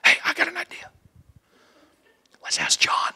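soft unvoiced whisper. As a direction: lean in and whisper the idea. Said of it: "one of them says this".

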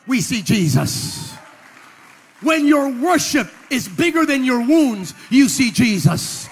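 rally the room, drive every line home; booming motivational speech. Acted. Booming call to the congregation.

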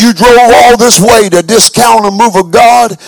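loud, stern rebuke; preacher scolding. Retold or imitated. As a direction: scold him like an angry pastor. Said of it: "He said".

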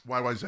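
Y Y Z ending in "zed"; Canadian zed. Shown as a demonstration. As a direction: pronounce the Z of Y Y Z as "zed". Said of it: "that's correct".

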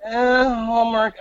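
high-pitched, wary "uh"; mock hesitant objection; retold uneasy doubt. Retold uneasy reaction to the film.

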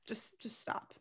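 exasperated whisper, just stop; weary whispered just stop; whispered plea. Real, not acted. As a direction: whisper it, fed up. Exasperated plea about character.

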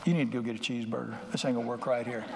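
low, soft, fast wry muttered thought; dry inner aside. Retold or imitated. Preacher voicing his doubt about halfhearted folks, muttered. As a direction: mutter it as a sceptical private thought. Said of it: "and I think".

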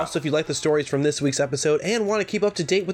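loud, high announcer read; podcast outro read. Acted. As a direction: pitch the show like a radio ad. Hosts read scripted outro.

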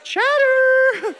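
high, loud mock squeal of chatter; a hammed-up high squeal; comic squeal. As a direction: shriek the word in laughing disbelief.

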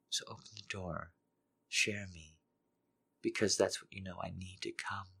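soft, breathy, pleading whisper-voice; soft desperate begging. Acted. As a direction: plead softly, hungry and desperate. Character begs in hushed voice.

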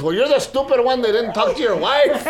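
high, loud laughing retort; hammed-up bro laughing; cheeky comeback. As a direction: fire back at the customer. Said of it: "and he goes".

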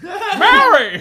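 very high, loud laughing cry; Jimmy Stewart impression. Friend doing his Jimmy Stewart bit, high loud call. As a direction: call out like an old movie star. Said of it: "I can do Timmy Stewart".

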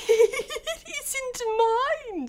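very high voice with a nervous giggle; nervous Hatter. Acted. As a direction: squeak it out through a jittery laugh. Frightened Hatter answers the King.

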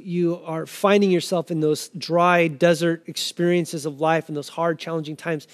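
normal talking voice

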